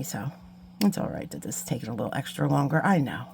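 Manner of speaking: ordinary talk, nothing marked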